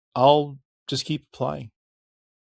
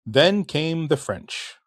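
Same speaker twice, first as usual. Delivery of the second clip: dramatic slow announcement; 5 semitones higher, much slower than usual. Acted. Dramatic history turn.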